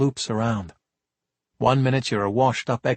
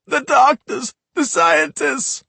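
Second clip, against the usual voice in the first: higher, slow wailing sob; tearful plea; 14 semitones higher, 5 dB louder, slower than usual. Acted. Drugged kid sobs to the cops, wailing. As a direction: beg through tears. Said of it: "burst into tears".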